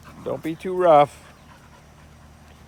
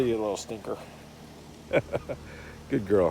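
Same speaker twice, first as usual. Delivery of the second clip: usual speaking voice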